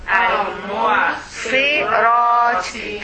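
"al-mu'sirati" slowly by syllables, the "ra" drawn out; long vowel. Shown as a demonstration. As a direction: stretch the "ra" in "al-mu'sirati". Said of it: "long vowel".

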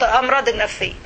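ordinary delivery

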